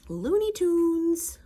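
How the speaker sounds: high, loud, stretched cartoon voice; cartoonish exclaim. Real, not acted. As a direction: call out like a cartoon announcer. Exclaiming at the dog.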